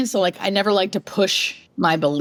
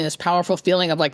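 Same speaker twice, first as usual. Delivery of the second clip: ordinary talk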